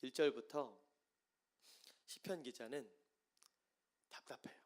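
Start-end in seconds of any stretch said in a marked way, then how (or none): none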